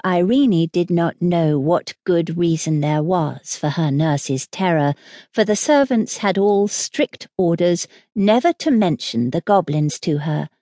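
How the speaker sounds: plain reading voice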